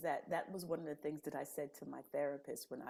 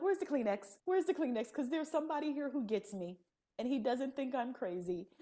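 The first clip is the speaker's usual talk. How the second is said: high, excited tearful joking; mock emotional; 8 semitones higher, 3 dB louder than usual. Retelling her relief to the therapist, mock tearful. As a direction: playfully overcome.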